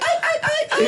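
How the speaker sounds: high falsetto ay ay ay; mock cat call. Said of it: "They're like".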